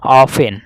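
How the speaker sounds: "ऑफ एन", F straight into the vowel; often with silent T. Shown as a demonstration. Teacher repeating the reading for students, T silent in "ऑफ एन". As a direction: leave out the T in "ऑफ एन". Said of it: "यहाँ से समाप्त हो जा रहा है".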